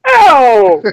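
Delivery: high mock "ow"; drawn-out put-on yelp; imitated scream. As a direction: mimic her odd little scream. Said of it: "Yeah, screaming".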